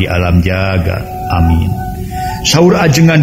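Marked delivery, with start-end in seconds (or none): none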